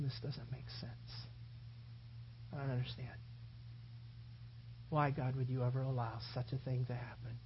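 Mary at the cross, not understanding; broken whisper of Mary. Acted. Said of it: "Mary, is sitting there going".

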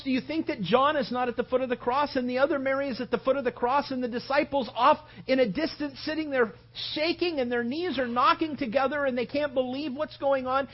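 normal talking voice

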